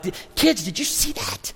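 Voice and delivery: very high, charged-up retelling; fired up. Retold or imitated. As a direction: act out a dad bursting to tell the kids. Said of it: "I'm fired up".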